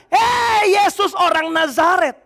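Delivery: slow, far-off demon voice shouting; demon's shout. Acted. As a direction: bellow it as the possessed man. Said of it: "Lalu iblis itu bilang". (teriakan iblis yang lambat)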